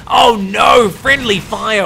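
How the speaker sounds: loud, high cry of dismay; real excited shout. Real, not acted. Caster watching friendly fire, crying out loud in dismay. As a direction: shout it like you can't believe the blunder.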